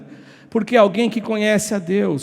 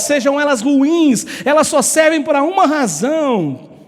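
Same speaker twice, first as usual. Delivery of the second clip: impassioned preaching; 5 semitones higher than usual. Real, not acted. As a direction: preach it with full conviction, punching the key words. (pregação fervorosa e enfática)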